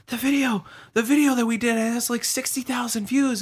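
high-pitched retelling of brother's news; imitating brother. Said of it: "my brother, like, woke me up".

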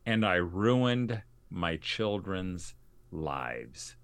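"ruined" and "lives" drawn out very slowly; emphatic. Retold or imitated. Mother confessing regret over sending kids to school, slow and emphatic. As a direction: weigh each part of "ruined my children's lives", pausing before "lives". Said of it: "she said emphatically to me".

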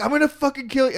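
high, strained threat from a pinned man; mock furious threat. Drunk man pinned by cops threatens them, high mock fury. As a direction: snarl it like a drunk under four cops.

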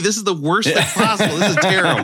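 high-pitched comic parental rant; mock exasperated outburst. Dad to daughter after her sauna, mock exasperated. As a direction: retell a parent losing patience, pitched well up.

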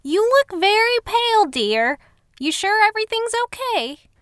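high, fluttery concerned neighbor voice; fussy older neighbor. Acted. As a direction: play the busybody lady, high. Cheryl voiced by narrator.

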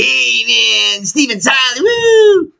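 put-on high-pitched eighties rocker kid, ending in a whoop; hammed-up rocker yowl. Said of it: "there's an eighties rocker kid who shows up".

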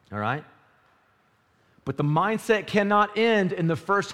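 loud, high preaching build. Acted. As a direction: drive the point home.

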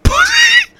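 sudden very high, loud shout; mock preacher's outburst. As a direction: yell the punchline out.

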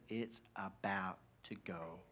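slow, drawn-out whisper; hushed warning. Acted. Elijah tips off Obadiah, slow conspiratorial whisper. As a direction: whisper it slowly like a secret.

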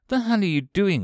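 ordinary delivery, nothing marked

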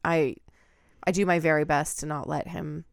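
normal talking voice

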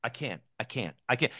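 softly repeated, small voice of giving up; defeated self-doubt. Acted. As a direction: mimic someone defeated, quietly repeating it. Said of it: "that seems to be the way it is".

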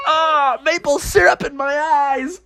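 high, wailing little character; high cartoon cry of distress; Froggy. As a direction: whine in pain at a high pitch. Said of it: "Froggy was like".

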